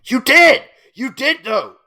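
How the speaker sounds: high, loud outraged yell; furious shout. Real, not acted. Yelling at the apologizer on screen, furious shout. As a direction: shout her down in disbelief.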